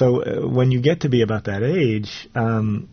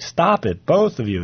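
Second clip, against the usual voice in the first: high, loud shout to break up a fight; a mother's sharp, high scream of stop; 7 semitones higher, 3 dB louder than usual. Acted. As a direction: mom yelling at two fighting boys. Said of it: "his mom screams".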